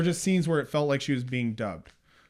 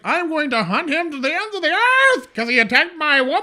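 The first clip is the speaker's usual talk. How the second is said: high, loud, hammed-up hero yell; passionate vengeance vow; 12 semitones higher, 8 dB louder than usual. Co-host imitating Frankenstein swearing to hunt his attacker, mock-passionate. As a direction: bellow it like an overwrought movie avenger. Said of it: "he was passionate".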